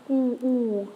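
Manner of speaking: "ooh" with rounded lips and full cheeks; a round monkey-like "ooh"; open French ou. Shown as a demonstration. Teacher demonstrates the open sound again. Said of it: "sounds a little bit like a monkey".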